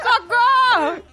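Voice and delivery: high, very slow drawn-out parrot squawk; the co-host mimicking the parrot, laughing; parrot cry for help. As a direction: stretch socorro like a parrot.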